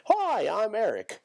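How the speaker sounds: high, drawn-out cheery introduction; regular-guy voice. Acted. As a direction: introduce yourself high and slow.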